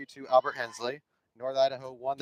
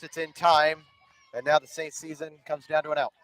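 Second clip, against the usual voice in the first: play-by-play; 3 semitones higher, 6 dB louder than usual. Acted. Live softball play-by-play.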